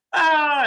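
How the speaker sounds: high, loud mock scream of terror; imitating a planeful of passengers shrieking; mock panicked scream. Imitating scared plane passengers, mock shriek. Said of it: "everybody on the plane is like".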